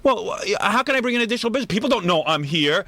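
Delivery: high, fast flustered protest; panicky Gary. Retold or imitated. Host imitates Gary pleading with his boss, high and rushed. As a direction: rush it out in a panic. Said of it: "Gary's gonna go".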